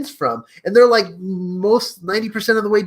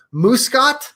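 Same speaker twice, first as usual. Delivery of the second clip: ordinary delivery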